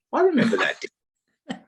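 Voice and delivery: high, put-on voice of an adult recalling a childhood memory; grown-up kid remembering. A grown-up former pupil remembering the costumed man in a lightly mocking high voice. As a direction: squeak it out, mock-puzzled. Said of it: "they go".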